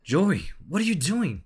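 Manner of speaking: high, loud startled shout; alarmed outcry. Acted. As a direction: cry out in disbelief at a friend. Character cries out at Joey.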